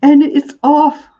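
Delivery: triumphant. Real, not acted. To viewers as the can lid comes off, a slow, loud triumphant cheer. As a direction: announce the win as the lid pops.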